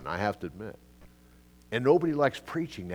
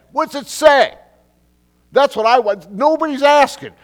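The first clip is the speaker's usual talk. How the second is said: high, loud rhetorical challenge; exasperated shout; 15 dB louder than usual. Real, not acted. Preacher shouting at the crowd, exasperated. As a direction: demand the answer, shouting. Mocking preacher's empty promise.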